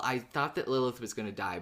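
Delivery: ordinary talk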